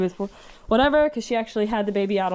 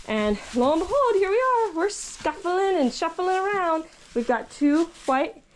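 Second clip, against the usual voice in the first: usual speaking voice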